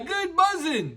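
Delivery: usual speaking voice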